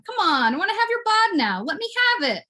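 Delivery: high, insistent begging; high pleading. Pretend. Begging the bud to give in, high pleading. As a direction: wheedle at the top of your voice's range.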